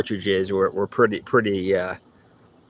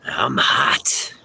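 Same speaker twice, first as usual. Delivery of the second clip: usual speaking voice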